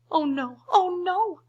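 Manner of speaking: high, loud "oh no" in mock panic; a buffalo cries out; mock alarmed cry. The buffalo begs the elephant, mock alarmed cry. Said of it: "cried the buffalo".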